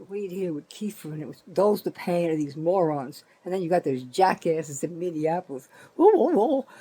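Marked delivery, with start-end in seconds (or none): 6.0-6.7 high, louder mocking ooh ooh ooh. Ranting vlogger mocks Minneapolis protesters with a sing-song ooh.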